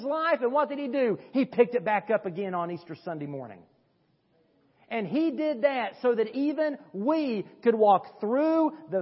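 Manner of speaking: high, loud, ringing preacher's climax; preaching at full force. Acted. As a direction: proclaim the victory over death. Easter climax of the sermon.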